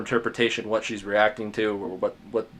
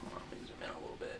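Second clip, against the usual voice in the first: aside; 21 dB quieter, faster than usual. Real, not acted. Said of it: "muttered aside while drawing".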